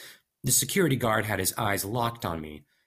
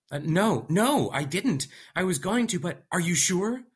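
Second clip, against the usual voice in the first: nervous denial; 10 semitones higher, 4 dB louder than usual. Acted. Narrator nervously denies.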